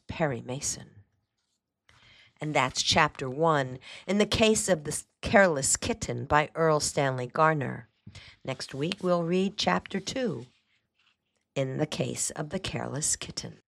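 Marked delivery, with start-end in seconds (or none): none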